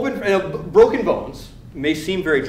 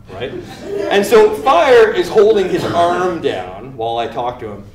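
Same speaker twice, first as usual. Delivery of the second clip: ordinary delivery, nothing marked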